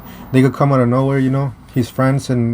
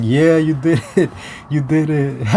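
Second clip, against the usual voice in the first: cheering friends; 2 semitones higher than usual. Retold or imitated. Friends cheer his win.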